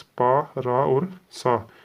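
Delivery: very slow, each part held apart; syllable by syllable. Shown as a demonstration. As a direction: read पा, रा, सा out like a teacher. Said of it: "ये इसको पढ़ने का तरीका है". (धीरे-धीरे पढ़ना)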